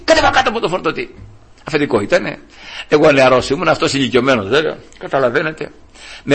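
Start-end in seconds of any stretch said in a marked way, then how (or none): 0.0-1.1 high, fast barked command; angry order. Retold or imitated. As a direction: chase him off the loader. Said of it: "ρε, μου λέει".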